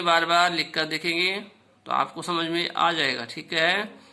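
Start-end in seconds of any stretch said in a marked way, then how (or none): none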